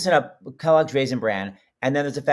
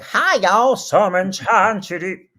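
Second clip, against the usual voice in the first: high, loud yokel hello switching into big singing; 5 semitones higher, 5 dB louder, much slower than usual. Retold or imitated. Host mimicking a singer's abrupt voice change, hammed up. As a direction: exaggerate the jump from talking voice to singing voice. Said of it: "when [name] was like".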